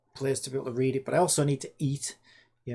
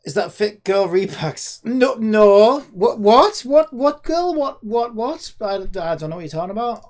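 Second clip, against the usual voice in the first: high, loud panicky protest; flustered denial; 6 semitones higher, 11 dB louder, slower than usual. Pretend. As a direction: play caught out and scrambling to deny it. Caught near piracy site, flustered denial.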